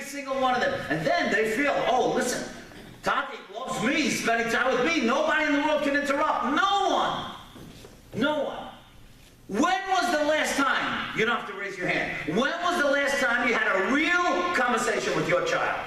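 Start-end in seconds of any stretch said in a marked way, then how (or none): 1.9-8.6 put-on higher, emphatic child's boast; a hint of a child proudly insisting; lightly voiced proud child. 9.5-15.8 high, insistent preacher's challenge; emphatic preaching. Real, not acted. Preaches to parents.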